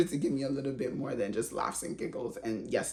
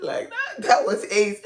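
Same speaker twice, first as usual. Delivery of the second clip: laughing through the words, high; words bubbling over with laughter; 6 semitones higher, 6 dB louder, slower than usual. Real, not acted. Vlogger laughing about Ace's gossip, laughing.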